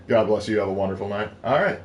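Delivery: ordinary talk, nothing marked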